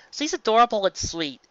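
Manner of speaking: ordinary delivery, nothing marked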